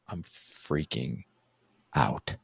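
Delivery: low, very slow, drawn-out admission; retold dread confession. Nervous dad-to-be confessing to stepmom, slow low dread. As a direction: stretch each word out, low, with a pause before the last.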